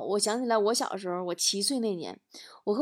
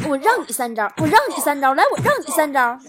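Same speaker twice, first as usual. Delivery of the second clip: loud, higher, punched mock shouting; angry dad; 5 semitones higher, 7 dB louder than usual. Dad chases and beats his child, acted angry taunt. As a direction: drive each repeat home like a blow. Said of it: "边打还边说".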